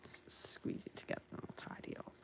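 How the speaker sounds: whisper. Real, not acted. Concentrating on glue.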